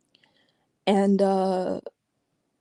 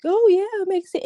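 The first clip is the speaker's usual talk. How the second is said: mock agreeable; 11 semitones higher than usual. Mocking their own blind agreement.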